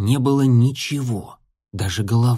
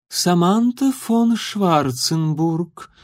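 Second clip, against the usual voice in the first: slow, higher, mincing aristocrat; affected, mincing; 6 semitones higher, much slower than usual. A narrator's reading. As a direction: draw the name out affectedly. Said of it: "жеманно произнесла бледная барышня".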